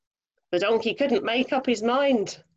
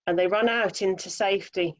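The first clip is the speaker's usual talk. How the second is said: plain reading voice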